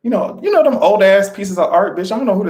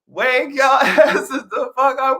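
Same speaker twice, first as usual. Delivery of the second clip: shouted through a laugh; laughing wake-up call; 8 semitones higher, slower than usual. Pretend. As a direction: yell it but crack up midway. Second take breaking into laughter.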